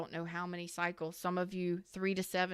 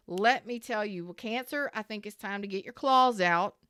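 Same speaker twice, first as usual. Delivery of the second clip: ordinary talk, nothing marked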